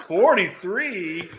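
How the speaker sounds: very drawn-out incredulous question; slow, affronted echo; indignant disbelief. Real, not acted. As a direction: stretch it out as if insulted. Objects to his age being overstated.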